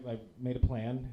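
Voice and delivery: normal talking voice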